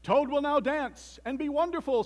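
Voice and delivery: high, loud showman's announcement; grand stage announcer calling out; strange faraway announcer. A narrator's reading. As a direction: boom it out to a whole theater. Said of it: "said the voice".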